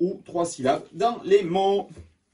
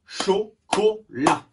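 slow "Chocolat" in three even beats; syllable by syllable; 3 semitones higher, much slower than usual. Shown as a demonstration. Teacher repeats after counting to three, syllable by syllable. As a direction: put a gap after each syllable of "Chocolat".

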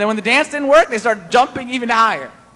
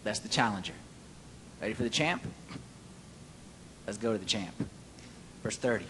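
hushed aside; 11 semitones lower, 16 dB quieter, faster than usual. Real, not acted. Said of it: "boxing announcer framing".

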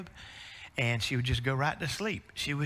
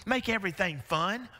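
ordinary talk

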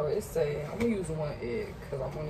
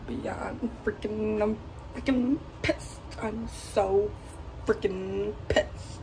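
high, slow, loud stretched-out complaint; drawn-out frustration; 5 semitones higher, 5 dB louder, much slower than usual. Real, not acted. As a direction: stretch every word out, fed up. Venting about empty fridge.

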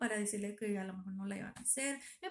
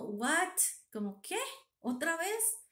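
retold disbelief, rising high at the end; incrédula; 7 semitones higher, slower than usual. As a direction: react in stunned questions, with pauses between. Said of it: "Y yo así como". (incrédula, preguntando con asombro)

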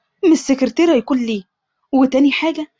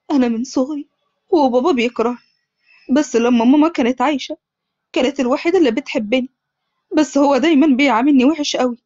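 ordinary delivery, nothing marked

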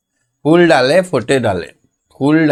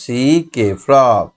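slow, letter-by-letter spelling; slower than usual. Shown as a demonstration. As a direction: spell each letter clearly for the class. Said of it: "এটা হচ্ছে frock". (ধীরে ধীরে বানান বলা)

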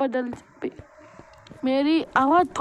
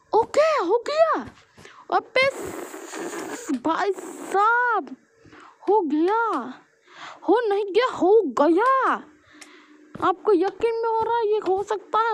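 excited surprise; 7 semitones higher, slower than usual. Real, not acted. Parkour trick suddenly works.

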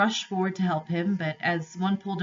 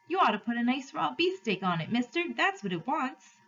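put-on high-pitched butcher's boy giving advice; 7 semitones higher than usual. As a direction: pipe up as a helpful errand lad.

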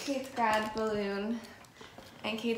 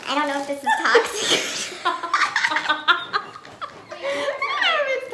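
high, squeaky and laughing; helium voice; 12 semitones higher, 6 dB louder than usual. Real, not acted. After inhaling helium.

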